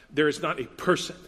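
ordinary delivery

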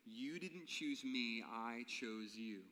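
very slow, each word weighed; slow emphasis. Acted. Jesus looking his disciples in the eye, slow and solemn. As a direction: lay it down slowly like a solemn promise.

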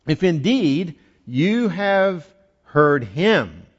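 slow, deliberate reading landing on "him"; stress on HIM. Shown as a demonstration. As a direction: draw it out slowly, weighting the word "him". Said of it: "You gotta read it that way".

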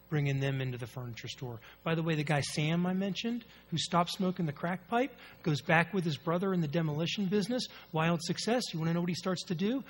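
normal talking voice

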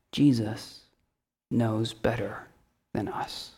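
fading to a whisper; hushed. Real, not acted. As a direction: let it drop to a hush. Quiet climax.

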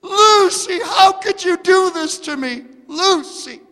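high, loud calling out; imitating screaming. Owner screaming for her dog from the minivan, high and loud. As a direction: wail the dog's name down the street. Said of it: "screaming out".

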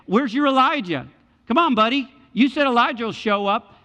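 loud, high jeer at a victim; mocking cry. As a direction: call out tauntingly, pitch pushed up. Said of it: "They're crying out and say".

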